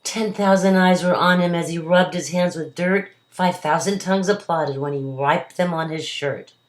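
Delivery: lively storybook poem reading; reading to a toddler. A narrator's reading. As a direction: read the ballad to a child. Reads the poem aloud.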